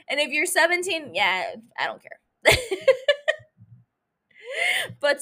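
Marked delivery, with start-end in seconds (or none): 2.4-3.4 high, loud laugh; high-pitched laughing fit; burst of laughter. Real, not acted. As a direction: crack up at your own remark. Laughs at own remark.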